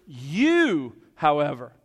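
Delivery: preacher lands hard on You to point at the listeners; stress on you. Shown as a demonstration. Preacher showing the congregation the stress on You. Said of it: "placed there for emphasis".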